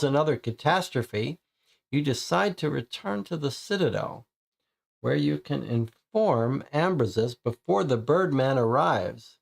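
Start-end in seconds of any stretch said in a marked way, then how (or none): none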